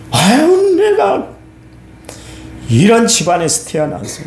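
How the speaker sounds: high, whiny mock complaint; complaining believer. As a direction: whine like a grumbling churchgoer.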